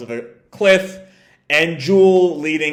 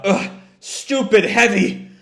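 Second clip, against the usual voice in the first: straining; 5 semitones higher than usual. Retold or imitated. Tangle pulling Belle up.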